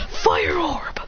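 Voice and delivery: high breathy stage-whispered shout; attack name whisper-shout. Acted. Adrian launching his fire orb, whisper-shouting the attack. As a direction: hiss out the attack name like a hero. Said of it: "He goes".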